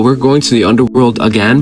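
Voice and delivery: normal talking voice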